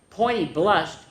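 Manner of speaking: usual speaking voice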